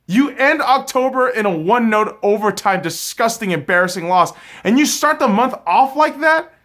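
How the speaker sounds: loud, high scolding rant; angry rant. Real, not acted. Fan scolding the Knights for losing, a loud, high rant. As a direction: tear into the team.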